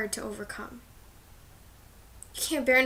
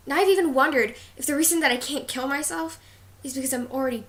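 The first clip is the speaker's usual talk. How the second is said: louder, higher burst of despair; anguished outburst; 3 semitones higher, 7 dB louder than usual. Acted. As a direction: let the desperation surge up. Confesses despair in a monologue.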